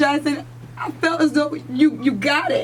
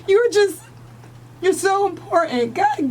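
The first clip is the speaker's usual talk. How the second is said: ordinary delivery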